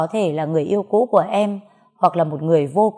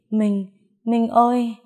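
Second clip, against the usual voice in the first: delirious calling out, drawn out; 4 semitones higher, slower than usual. A narrator's reading. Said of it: "Tiếng em gọi tên người ấy triền miên".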